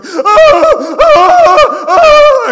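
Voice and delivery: very high, loud gasping cries; hammed-up air-starved gasps; desperate gasps for air. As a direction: gasp like you just surfaced from drowning. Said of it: "I'm like".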